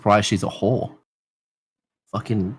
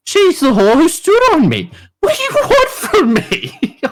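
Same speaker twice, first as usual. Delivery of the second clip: high-pitched, hammed-up whine of protest; mock-indignant, very high voice; exasperated protest; 4 dB louder than usual. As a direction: plead your innocence in a squeal. Acting out his protest to mate.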